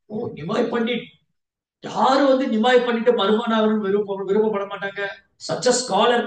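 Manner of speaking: a proud father bursting with praise for the groom; eager praise. Acted.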